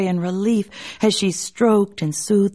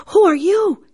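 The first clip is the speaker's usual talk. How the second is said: frightened; 12 semitones higher, 4 dB louder than usual. A narrator's reading. Man wakes in snake's coils.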